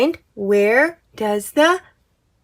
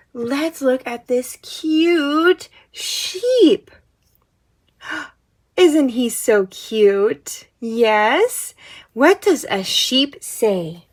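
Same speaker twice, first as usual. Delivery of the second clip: put-on soft, slow toddler talk; reading to a toddler; 5 dB quieter, slower than usual. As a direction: coo it gently to a little one. Talking to toddler about puzzle.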